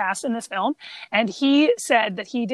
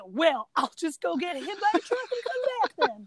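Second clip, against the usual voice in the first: a hint of a cocky Southern boy, higher; sassy kid quote; 5 semitones higher, 4 dB quieter than usual. Reciting the movie line with a hint of sass. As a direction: toss it off with lightly mocked attitude. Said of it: "the quote that I want is".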